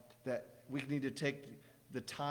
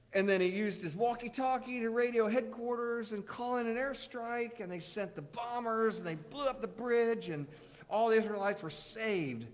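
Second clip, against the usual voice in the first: put-on higher, eager kid storytelling; little boy voice; 9 semitones higher, 4 dB louder than usual. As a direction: tell it like an excited child. Joey voiced in joke.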